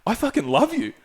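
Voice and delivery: mildly hurt, high-pitched retold protest; hurt plea. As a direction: plead with a friend who just turned on you. Pleading with drunk friend.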